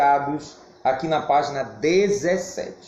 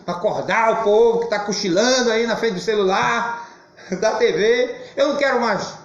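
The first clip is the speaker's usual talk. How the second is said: voz mais aguda, subindo de intensidade; berro de pregador; 6 semitones higher, 3 dB louder than usual. Shown as a demonstration. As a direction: erga a voz para acordar quem cochila. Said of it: "não dar meus berros de vez em quando". (berrando de leve, para despertar o povo)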